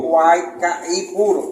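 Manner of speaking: Guaycaipuro slowly, syllable by syllable, like a scribe writing it down; slow spelled-out name. Retold or imitated. Mimicking the Spanish scribe, drawing out Guaycaipuro slowly. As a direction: draw out Guaycaipuro as if writing it by ear. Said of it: "lo que más o menos pudo".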